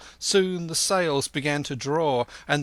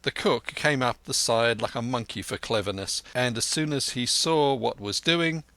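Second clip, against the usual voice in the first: ordinary delivery, nothing marked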